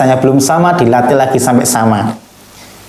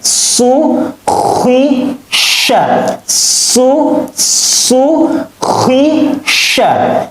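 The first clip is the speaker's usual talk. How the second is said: high, crisp Quran letters; fast, firm recitation; 10 semitones higher, much slower than usual. Shown as a demonstration. Teacher shows pupils fast, firm letter reading, high and crisp. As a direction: snap out each syllable firmly. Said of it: "Samakan dengan lisan Ustadz".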